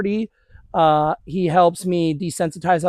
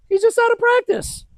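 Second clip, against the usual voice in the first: high dog praise voice. Real, not acted.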